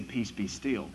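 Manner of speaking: softly spoken calm authority; calm command. Retold or imitated. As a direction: say it gently, full of quiet authority. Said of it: "doesn't have to scream and yell".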